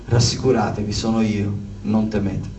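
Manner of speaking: low, softened reassuring voice; soothing and low-pitched; calm reassurance. Retold or imitated. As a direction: quote it gently, dropping low to settle their fear. Retold voice of Jesus calming disciples.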